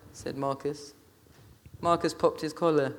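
ordinary delivery, nothing marked

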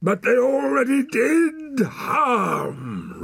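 slow, high, loud roar. A narrator's reading. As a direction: roar the accusation, stretching the words. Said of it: "roared the man".

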